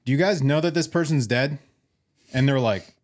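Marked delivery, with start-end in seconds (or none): 0.0-1.6 mild mock yell, pitched higher; half-hearted retold holler. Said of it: "And he yells, he goes".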